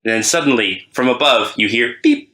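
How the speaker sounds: normal talking voice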